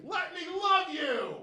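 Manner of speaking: very high, slow, drawn-out shrieking plea; screamed plea. Retold or imitated. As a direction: shriek it as the cat runs off. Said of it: "he just screamed".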